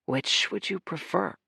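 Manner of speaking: put-on a secretive little boy.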